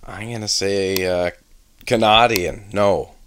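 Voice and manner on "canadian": "Canadian" said as "kuh-NAH-dee-un"; punned pronunciation. Real, not acted. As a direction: open the middle of "Canadian" to "nah". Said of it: "Canadian or not?".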